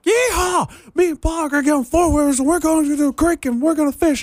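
high, hammed-up country holler; thick country accent. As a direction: whoop and gabble like a country girl. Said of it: "thick, like, country accent. So I did that".

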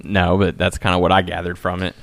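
ordinary delivery

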